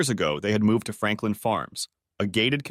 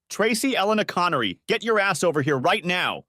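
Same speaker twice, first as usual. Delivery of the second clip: furious father, pitched up high; angry roar; 8 semitones higher than usual. A narrator's reading. Father roaring at his daughter to come here, angry. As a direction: bellow the full name in rage. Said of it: "roared Al".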